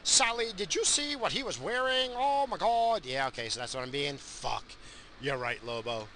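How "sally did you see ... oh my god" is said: a light high mock of gossiping girls; lightly voiced gossip girl. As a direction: ham up a gossipy friend a little. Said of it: "what girls complain about".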